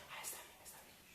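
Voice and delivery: very soft whispered okay; hushed, yielding reply; whispered compliance. Shown as a demonstration. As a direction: whisper back in quiet agreement. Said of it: "Al primero quizás le digas".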